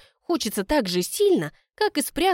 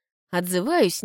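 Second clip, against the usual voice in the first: plain reading voice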